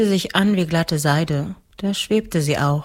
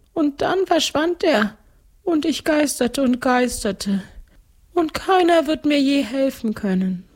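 very high ghost-count voice; gluttonous ghost count; 10 semitones higher than usual. Acted. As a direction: recount it as a high-pitched ghost nobleman. (hohe Stimme eines Gespenstergrafen)

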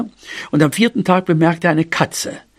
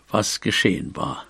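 ordinary delivery, nothing marked

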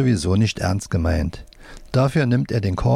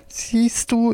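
high-pitched puppet voice; left-hand puppet; 13 semitones higher than usual. A narrator's reading. Left hand points out the boy isn't crying, high puppet voice. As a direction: pipe up as a little hand puppet. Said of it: "Wolfram mit der linken Hand".

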